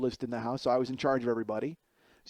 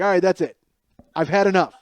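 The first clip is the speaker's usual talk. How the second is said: louder, raised fed-up voice; fed-up outburst; 5 semitones higher, 10 dB louder than usual. Retold or imitated. Younger brother snapping, raised fed-up voice. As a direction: had enough, let it out loud.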